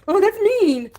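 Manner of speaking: high mocking whine; sneering imitation of a scolding viewer; mocking whiny voice. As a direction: parody the prissy chatter. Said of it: "Every time I have something to say".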